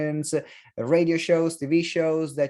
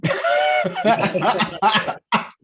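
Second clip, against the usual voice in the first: loud, high burst of laughter; hearty laugh; 8 semitones higher, 6 dB louder than usual. Real, not acted. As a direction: laugh out loud at your own joke. Host laughs at own food joke.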